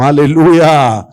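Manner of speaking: loud, drawn-out cry; rousing hallelujah. Real, not acted. Preacher rallying congregation after his declaration, rousing shout. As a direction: proclaim it long and loud.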